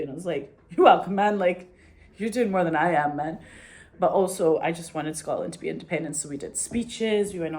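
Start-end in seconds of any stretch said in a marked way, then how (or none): 0.7-3.4 loud, bright-eyed fan gushing back; eager thanks. Retold or imitated. Young fan loudly thanking Alex Salmon, excited near-shout. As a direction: gush loudly at the politician, almost shouting.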